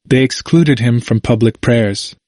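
ordinary delivery

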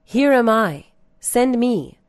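high, loud declaration; prophet's response. A narrator's reading. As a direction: call it out as a volunteer answering God. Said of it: "And Isaiah responded".

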